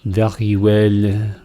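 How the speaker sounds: put-on slow, hammy French accent with a rolled r on very; mock French accent, slowed right down. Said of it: "I was trying to do a French accent".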